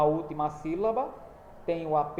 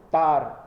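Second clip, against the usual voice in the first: "tar" said louder, on its own; a loud, isolated "tar"; stressed syllable; 8 dB louder than usual. Shown as a demonstration. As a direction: punch out "tar" as the strong syllable. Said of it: "qual é a sílaba que é pronunciada com mais força".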